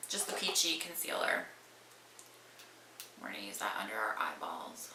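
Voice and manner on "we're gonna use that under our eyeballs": soft whispered aside; whispering. Real, not acted. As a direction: breathe it under your breath. Whispered aside while applying concealer.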